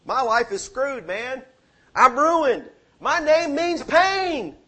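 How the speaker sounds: high, loud mock lament, slowing at the end; hammed-up wail of a ruined man; acted desperate cry, building to a drawn-out shout. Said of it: "Jabez cried out to the God".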